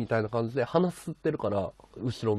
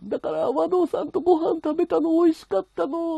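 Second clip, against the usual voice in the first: high imitation of her crying, a touch louder; a mild tearful girl's voice, pitched up; girlfriend crying; 4 dB louder than usual. Streamer imitating girlfriend in tears, high crying voice. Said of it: "って言って号泣してる".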